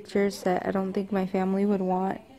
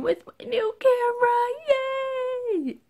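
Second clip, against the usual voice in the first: excited; slower than usual. Real, not acted. Excited about new camera.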